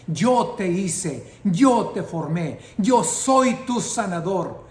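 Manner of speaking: loud shouted declaration; shouting. Retold or imitated. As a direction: proclaim it like shouting over her bed. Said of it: "y yo gritando". (gritando palabra de Dios)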